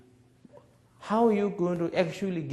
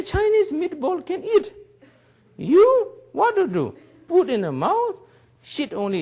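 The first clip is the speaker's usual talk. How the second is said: high, loud ribbing; mock teasing; 13 semitones higher, 6 dB louder than usual. Teacher teasing the young man about being useless, loud and high. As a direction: rub it in with a grin.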